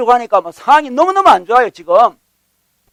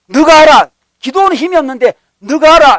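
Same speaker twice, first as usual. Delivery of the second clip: loud, higher delivery as God speaking; commanding; 5 semitones higher, 6 dB louder than usual. Retold or imitated. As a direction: drive home the divine order. God's command voiced in sermon.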